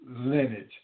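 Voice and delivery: ordinary delivery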